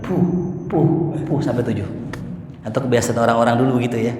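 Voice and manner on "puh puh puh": imitated spitting. Shown as a demonstration. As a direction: puff out the spits lightly. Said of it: "Rasul ludahin tujuh kali".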